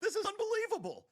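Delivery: high, loud mock disbelief; mock outrage. Host echoes cohost's outrage at the hire offer, hammed-up.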